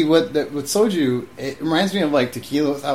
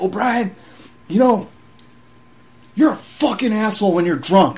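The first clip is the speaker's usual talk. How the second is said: scolding sergeant; 7 semitones higher, 4 dB louder than usual. Retold or imitated.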